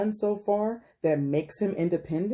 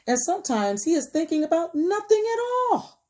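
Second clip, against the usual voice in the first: playful build-up; 13 semitones higher, 5 dB louder than usual. A narrator's reading. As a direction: climb high and hold the last word. Builds to story punchline.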